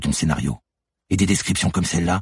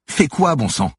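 much higher, louder demand; exasperated outcry; 10 semitones higher, 4 dB louder, slower than usual. Acted. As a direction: burst out at her accuser. Manon demands an answer, panicking.

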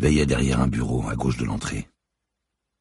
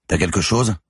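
raised-pitch call across the nave; 7 semitones higher, 4 dB louder than usual. A narrator's reading. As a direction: toss the question out to carry. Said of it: "Sa voix se répercuta contre les parois". (appel lancé à travers la nef)

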